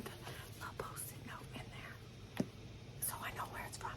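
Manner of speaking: hushed, voiceless whisper. Real, not acted. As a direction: breathe the words as if to keep a secret. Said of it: "I'm whispering".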